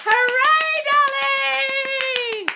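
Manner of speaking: very high, loud, whooped cheer; jubilant cheer. Real, not acted. Coach celebrating a listener's changed self-concept, jubilant cheer. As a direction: cheer them on at full voice.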